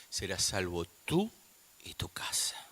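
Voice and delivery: low verse fading into a whisper; hushed promise. Real, not acted. Preacher closes a conversion story with the promise, hushed. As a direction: sink to a whisper by the end.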